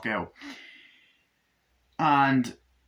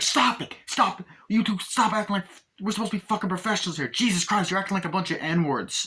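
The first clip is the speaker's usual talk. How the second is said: exasperated shout; 8 semitones higher than usual. Retold or imitated. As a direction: break up the fight, yelling. Boss breaking up fight.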